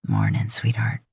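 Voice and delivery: very low sleepy man's voice. A narrator's reading. Man greets his lover at dawn, very low and sleepy. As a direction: croak it from deep sleep.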